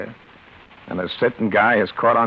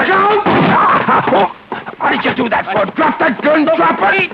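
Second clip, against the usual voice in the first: much higher and louder panicked yells; urgent shouting; 7 dB louder than usual. Acted. Tim yelling at the armed man to drop the gun, frantic shout. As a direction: shout the warning at full volume. Gunman draws on them.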